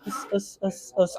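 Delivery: soft hissed punch noises; quick little "ss" jab sounds; imitated light jabs. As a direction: puff out gentle sparring noises. Imitating light jabs while playing nice.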